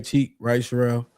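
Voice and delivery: usual speaking voice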